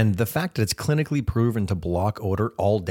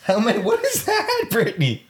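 high wheezing laugh through words; wheezy laughing; 14 semitones higher than usual. Real, not acted. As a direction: squeak the words out while laughing. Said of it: "You're sounding like me. You're wheezing".

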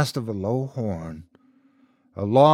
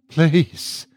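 higher, pleading single word; begging; 5 semitones higher, 3 dB louder than usual. A narrator's reading. Man begs priest to absolve him, pleading. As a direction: beg with it. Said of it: "the man begged now".

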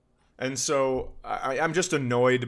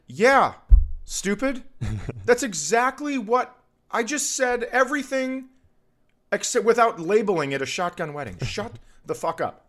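high-pitched, insulting comeback; hammed-up exasperation; exasperated retort; 10 semitones higher, 4 dB louder than usual. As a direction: snap back at a stupid heckler, pitch jumping up. Acted retort to the heckler.

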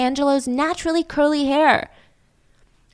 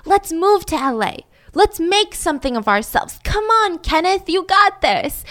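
hyped-up pep talk; 7 semitones higher than usual. Retold or imitated. Pep talk voiced, higher.

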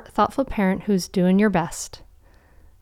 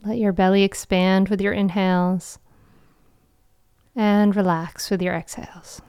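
guided meditation. Acted. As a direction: guide them gently into calm.

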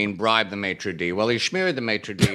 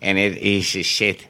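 South African accent, vowels flattened; a quick South African accent impression; 3 semitones lower than usual. Shown as a demonstration. South African accent for the talk show crowd. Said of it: "That's my impression of a South African accent".

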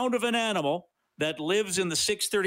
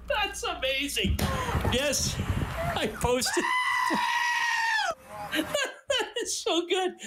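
delighted laughter; 14 semitones higher, slower than usual. Real, not acted. Host guesses the goat, delighted.

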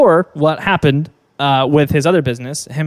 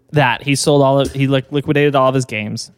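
usual speaking voice

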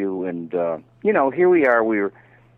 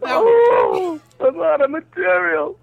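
high, loud strained comic voice; bombing comedian; 4 dB louder, much slower than usual. Acted. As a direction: play a desperate comic onstage. Hammed-up comedian bit.

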